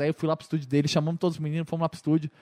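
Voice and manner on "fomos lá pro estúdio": normal talking voice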